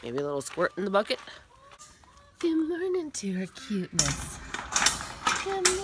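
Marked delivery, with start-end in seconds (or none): 2.4-5.8 high, cooing hello; sing-song greeting. Real, not acted. Greeting her cuteness at milking, high sing-song. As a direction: coo it high to a beloved animal.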